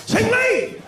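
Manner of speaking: high shout of a name; loud high call across the room. Retold or imitated. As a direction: yell the friend's name to wake him. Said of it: "I be like".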